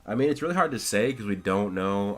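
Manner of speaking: usual speaking voice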